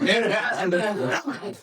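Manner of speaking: a real, rising laugh at the host's quip; amused laugh. Real, not acted. A seminar guest laughs at the host's offer of help.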